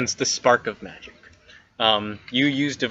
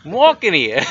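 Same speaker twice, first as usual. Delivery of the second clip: high Boston weasel swagger; 4 semitones higher, 4 dB louder than usual. Acted. Weasel joking back to the players, Boston weasel voice. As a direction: protest like a brash Boston weasel. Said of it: "A weasel from Boston?".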